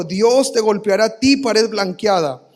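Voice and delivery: acted loud rebuke, drawn out at the end; indignant exclamation. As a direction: hurl it as a condemning outburst. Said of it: "no sé si lo dijo en ese tono".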